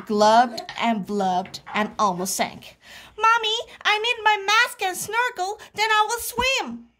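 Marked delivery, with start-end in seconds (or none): 3.2-6.9 put-on high, rushed child voice; pleading little frog. A narrator's reading. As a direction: beg mommy in a high hurry.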